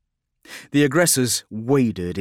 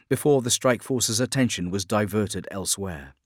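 usual speaking voice

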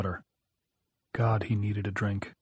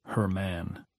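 much softer, lower murmur of the phrase; softly musing; 3 semitones lower, 7 dB quieter than usual. A narrator's reading. Wife in the dark murmurs the phrase softly to herself. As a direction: savour the words to herself.

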